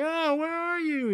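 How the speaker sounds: high, slow fake call. Misty fakes calling for her lost bestie, high and slow.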